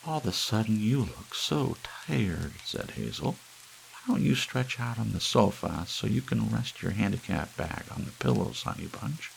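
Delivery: high doting Hazel; Hazel's voice. A narrator's reading. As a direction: coax him gently onto the sofa.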